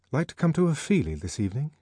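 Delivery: voiced Henry, quick and keen; Henry inviting. A narrator's reading. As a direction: toss out a breezy offer.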